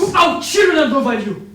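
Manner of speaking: high, loud yell cutting him off; angry outburst; furious shout. Acted. Prisoner shouts back in argument.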